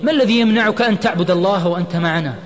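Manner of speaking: high, loud pleading argument; heated protest. A narrator's reading. Imad grips departing Osama, heated protest. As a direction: argue forcefully to hold him back.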